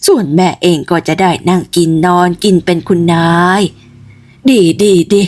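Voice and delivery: put-on low, old woman's cracked prophesy; crazy old woman. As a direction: foretell the girl's fortune like a mad old crone. Crazy old Yai Mon prophesies. (ยายมนคนบ้าทำนายโชคเด็กสาว เสียงต่ำแบบหญิงชรา)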